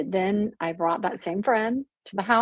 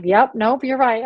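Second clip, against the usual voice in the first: quick, louder, slightly higher agreeing; wry agreement; 4 semitones higher, 6 dB louder than usual. Retold or imitated. Retelling agreeing with her husband, wry and a bit louder. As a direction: toss it off with a knowing laugh behind it. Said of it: "I'm like".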